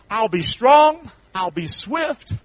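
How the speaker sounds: slow emphatic. Real, not acted. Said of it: "slow emphatic scripture".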